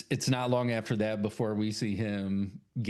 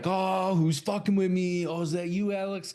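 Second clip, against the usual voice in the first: raised, higher-pitched challenge; mock indignant reaction; 7 semitones higher, 4 dB louder than usual. [name] calling out whoever moved the clown, mock indignant. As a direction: call it out like a pranked man.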